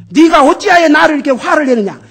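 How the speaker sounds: loud, high booming question; God demanding why Jonah is angry, booming loud; God's booming rebuke. Retold or imitated. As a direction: thunder it down as God would. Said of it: "하나님께서 요나에게".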